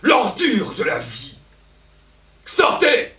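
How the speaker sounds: high raging shout; furious shout. Acted. Elvire driving Don Juan out, with a high raging shout. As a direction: throw him out with a scream. Insults and orders him out.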